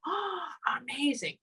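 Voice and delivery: high, soft, drawn-out awe; mock amazement. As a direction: gasp it slowly as if astonished. Acts out listener's future amazement.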